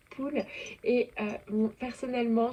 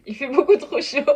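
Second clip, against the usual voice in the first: exasperated outburst; 4 semitones higher, 10 dB louder than usual. Real, not acted. Complaining about the summer heat.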